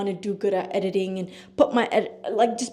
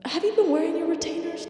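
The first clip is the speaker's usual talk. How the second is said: higher, softer nosy questioner voice; curious follower; 9 semitones higher, 6 dB quieter than usual. Pretend. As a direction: ask it like a pushy fan. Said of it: "Like, just randomly ask, like".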